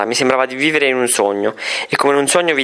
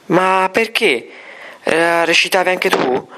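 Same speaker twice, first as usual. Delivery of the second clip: higher, slow, disbelieving voice; astonished; 6 semitones higher, slower than usual. A narrator's reading. Teacher realising the girl acted, slow astonished. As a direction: drag out the disbelief. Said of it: "sgranò tanto d'occhi".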